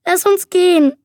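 a frightened plea to leave; pleading. A narrator's reading. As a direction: beg to be let go. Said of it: "flehte Murphy".

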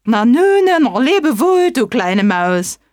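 loud, high hasty farewell call; calling snake. Acted. Snake calling goodbye to the mouse as it flees. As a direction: call out and hurry away. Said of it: "rief sie".

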